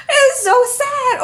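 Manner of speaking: fake-cry it over the top; mock crying. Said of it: "This is so sad".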